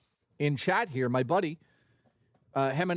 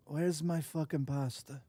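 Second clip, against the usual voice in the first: soft, slow put-on Italian accent; mock Italian accent; 6 dB quieter than usual. Shown as a demonstration. Streamer demonstrates his accents to viewers, soft mock Italian. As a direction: draw it out quietly in mock Italian. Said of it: "The accents are killing me".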